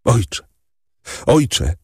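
gently plead, pitched above narration; urgent appeal. A narrator's reading. Julia in her father's arms, appealing to him with restrained distress. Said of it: "mówiła, „bądź odważny".

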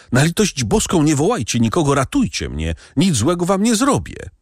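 frantic pleading. A narrator's reading. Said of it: "zawołał blady i półprzytomny".

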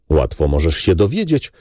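ordinary delivery

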